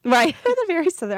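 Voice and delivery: amused, high, loud burst through a laugh; laughing agreement. Real, not acted. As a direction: agree quickly, laughing at the tease. Laughs at interviewer's accent quip.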